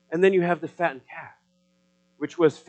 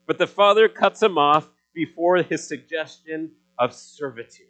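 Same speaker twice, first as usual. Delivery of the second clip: normal talking voice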